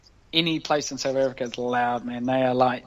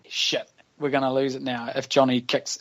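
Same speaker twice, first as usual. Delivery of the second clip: ordinary talk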